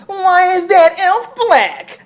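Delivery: high, slow, loud mocking whine; mock online whiner. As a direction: drag it out in a high complainer's voice. Mocking online complainers' whining.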